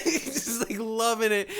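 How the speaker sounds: ordinary talk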